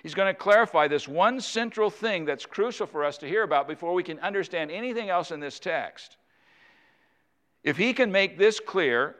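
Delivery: normal talking voice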